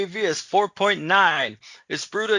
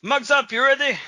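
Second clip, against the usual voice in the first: hearty toast call; 8 semitones higher, 4 dB louder than usual. Real, not acted. Calling for the toast.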